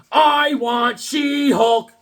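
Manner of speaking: put-on slow, loud, high childlike demand; drawn-out whiny want; hammed-up begging cry; mock demand. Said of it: "I'm like".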